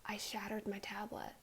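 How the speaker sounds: very soft, breath-only admission; whispered confession. Retold or imitated. Daughter admitting to mom about the tablet, whispered.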